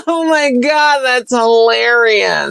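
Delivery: high fake laugh into gushing praise; hammed-up, high-pitched amused moviegoer; mock audience laughter. Host mimics the audience at a lame joke, high fake hilarity. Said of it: "everyone's gonna go".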